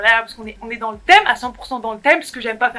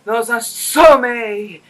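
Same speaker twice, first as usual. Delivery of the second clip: slow, loud, solemn storybook voice; dramatic fairy-tale narrator; 7 dB louder, much slower than usual. Pretend. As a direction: ham up the grim ending like a movie trailer. Mocking the film's dramatic ending.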